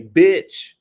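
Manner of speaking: sharp yelled bitch; angry husband snapping; yelled insult. Retold or imitated. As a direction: bark the word at her. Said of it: "I heard the husband say".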